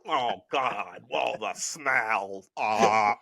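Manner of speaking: low, dragged-out groaning disgust; mock squeamish man. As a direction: imitate a man recoiling, in a dopey low moan. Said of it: "and you're just like".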